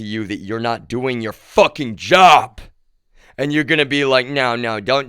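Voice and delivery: heated mocking rant.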